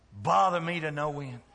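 ordinary talk

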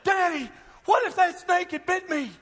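put-on high, loud child's protest; upset daughter. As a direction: exclaim it like an alarmed little girl to her daddy. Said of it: "she looked at me and she said".

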